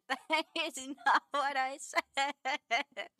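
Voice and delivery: drawn-out denial breaking into laughter; slow, laughing objection; laughing protest. Real, not acted. Protesting a clip while laughing.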